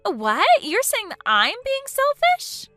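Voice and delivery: high, slow outraged question; can't believe she's called selfish; offended disbelief. Acted. Bride-to-be stunned at being called selfish, outraged.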